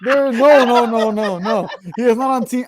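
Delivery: loud, high volley of refusals; emphatic protest. Real, not acted. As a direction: shout it down insistently. Emphatic protest at Pitino joining team.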